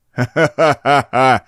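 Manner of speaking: slow rolling villain laugh; booming sorcerer laugh. A narrator's reading. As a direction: laugh like crashing surf. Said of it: "Смех Сарканда прозвучал точно рокот бушующего прибоя".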